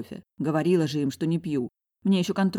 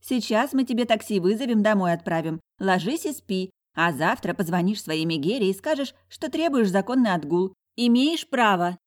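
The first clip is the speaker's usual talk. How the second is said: lively higher voice, pushing her tipsy friend; bossy friend, scornful; 5 semitones higher than usual. Acted. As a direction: boss your friend around with brash energy. Бойкая подруга командует. (бойкая подруга, напористо и свысока)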